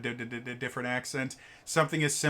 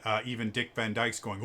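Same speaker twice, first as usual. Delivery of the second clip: ordinary talk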